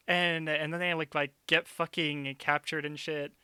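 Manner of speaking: ordinary delivery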